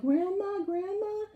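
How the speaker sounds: imitating crying calls. Grandson finds grandmother dead, mock wailing. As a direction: call out to her, half-mock grief. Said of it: "he's crying as he sees".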